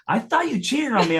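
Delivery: mock accusation in a high voice; imitating girlfriend. Imitating girlfriend admitting her suspicion, high-voiced. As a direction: pitch it up to mimic her confession. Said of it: "She's like".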